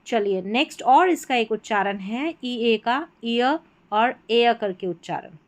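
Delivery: ordinary delivery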